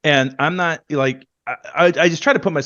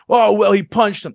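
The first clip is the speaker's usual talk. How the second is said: mocking critics; 7 semitones higher, 7 dB louder than usual.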